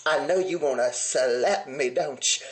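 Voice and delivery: low, slow menacing taunt; Kandi's mama's taunt. Retold or imitated. Mama taunting her daughter, low and slow. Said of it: "like, was like".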